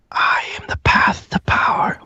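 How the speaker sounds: eerie whispered reveal; hushed, toneless ominous whisper. A narrator's reading. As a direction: whisper it as a mysterious presence in a cauldron. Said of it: "it kind of just whispers to you".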